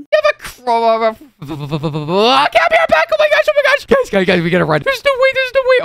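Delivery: high-pitched, flustered outburst; squealing, overwhelmed panic; flustered excitement. Acted. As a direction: freak out with giddy disbelief. Hears crush confession, freaks out.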